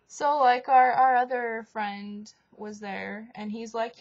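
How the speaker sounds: normal talking voice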